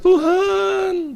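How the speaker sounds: high, drawn-out wail; imitated crying. Pastor calls on God while being washed, imitated crying. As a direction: act out a weeping cry to God. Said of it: "Rupanya itu sama nangis".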